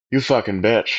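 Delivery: low, muted retold curse; retold angry insult. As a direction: mutter the insult low, re-enacting it. Retells cursing officer while handcuffed.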